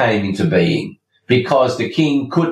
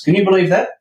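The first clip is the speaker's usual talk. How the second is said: incredulous; 10 semitones higher, much faster than usual. Real, not acted. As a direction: pitch jumps up in disbelief. Incredulous aside to listeners.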